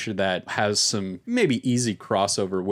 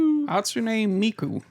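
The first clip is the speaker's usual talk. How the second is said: put-on old man voice; 14 semitones higher than usual. Echoing the Letterman impression.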